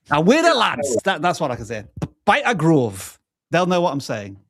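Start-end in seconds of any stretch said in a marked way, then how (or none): 0.1-1.0 mock Geordie accent, higher and louder; a hammed-up Newcastle Geordie line. Shown as a demonstration. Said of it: "say in a Newcastle accent". 2.2-3.2 playful attempt at a Geordie accent, a bit louder; a quick jokey Newcastle Geordie phrase. Shown as a demonstration. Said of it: "trying to do a Geordie accent".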